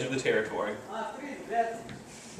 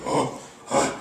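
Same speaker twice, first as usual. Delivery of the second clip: chimp pant; 2 semitones lower than usual. Shown as a demonstration. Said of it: "So a pant hoot starts with".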